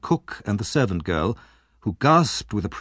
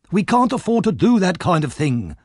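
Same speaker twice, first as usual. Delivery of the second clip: put-on higher, slightly louder old crook; 8 semitones higher, 3 dB louder than usual. A narrator's reading. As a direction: press the point like a sly old thief.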